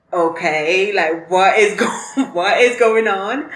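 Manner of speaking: loud, half-laughing disbelief. Retold or imitated. Hearing the school's rules, a loud laughing disbelief. School's new scoring confuses her.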